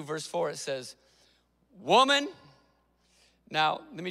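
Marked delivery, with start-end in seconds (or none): 1.8-2.3 a curt, louder "Woman," snapped out; blunt address. Retold or imitated. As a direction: call out "Woman," sharply, as if it sounds rude. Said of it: "it can sound a little offensive".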